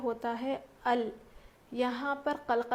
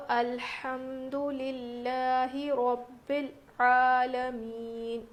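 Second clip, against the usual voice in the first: slow melodic tajweed recitation of الحمد للہ رب العالمین; slow melodic Quranic chant; much slower than usual. Shown as a demonstration. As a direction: chant the verse slowly with tajweed. Recites the verse as model.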